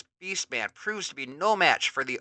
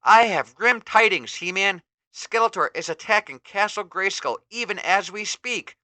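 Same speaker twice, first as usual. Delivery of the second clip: higher, urgent worried warning; worried sorceress; 7 semitones higher, 4 dB louder than usual. Acted. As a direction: deliver grim news with worry. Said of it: "declares in worry".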